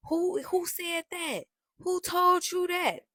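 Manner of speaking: high, slow, wide-eyed who-me voice; mock innocent denial. To her boyfriend on the phone, innocent mock disbelief. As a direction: play dumb, drawing out the outrage.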